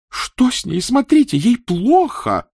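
high, loud panicked shout; panicked cry. Acted. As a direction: cry out in alarm. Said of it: "кричала Екатерина".

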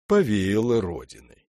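usual speaking voice

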